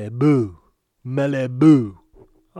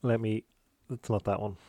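ordinary delivery

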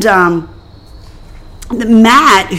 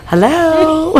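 sweet phone voice; 7 semitones higher than usual. Retold or imitated. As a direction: answer the phone all polite. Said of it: "really nice when she answers that phone".

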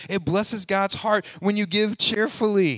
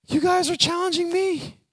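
higher, lightly voiced amazed Paul; imagined Paul; 9 semitones higher than usual. Paul telling eager givers they challenge him, lightly voiced. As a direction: play Paul overwhelmed by their eagerness. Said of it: "Paul probably looked at them and said".

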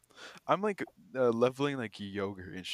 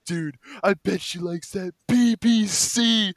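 leering stoned guy; 9 semitones higher, 12 dB louder, slower than usual. Retold or imitated. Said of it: "He goes".

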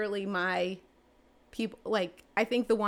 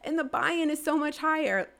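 high-pitched, louder, animated; excited emphasis; 8 semitones higher, 4 dB louder than usual. Real, not acted. Agent telling interviewer how thrilled New York buyers are, excited. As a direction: gush with amazement, pitch riding high.

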